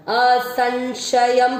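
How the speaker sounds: असंशयम very slowly ending in a clear म; careful teacher demo. Shown as a demonstration. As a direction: stretch असंशयम out, closing on म. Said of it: "वो है आधा म".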